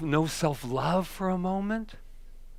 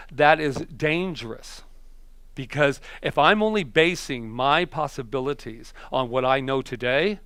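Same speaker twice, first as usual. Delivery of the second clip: ordinary delivery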